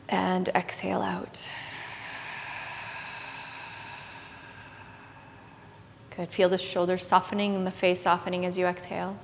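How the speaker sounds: hushed yoga class guidance; soft spa whisper. Acted. Leads a guided meditation.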